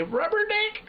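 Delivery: high squeaky yelp; silly yelp. Acted. Shouted punchline.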